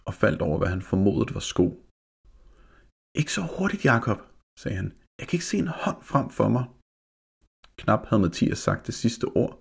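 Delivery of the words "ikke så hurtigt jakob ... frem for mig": put-on nervous boy. Scared boy voiced in story.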